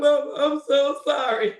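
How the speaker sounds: hammed-up sob of an apology; playful imitation of a teary daughter; mock crying. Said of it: "I'm like".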